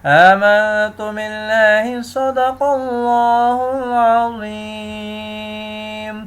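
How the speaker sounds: slow, melodic tajweed recitation; Quran recitation. Acted. Teacher recites the whole surah for students, melodic chant. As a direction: chant the surah slowly and evenly.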